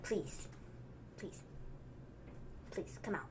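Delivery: soft, low pleading with long pauses; Murphy. Retold or imitated. Podcaster as Murphy asking them to come out, hushed plea. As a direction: beg very quietly, imitating a humbled wrestler. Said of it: "He says it twice".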